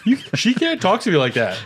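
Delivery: high indignant character voice; hammed-up offense; mock offended. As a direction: play a huffy offended woman.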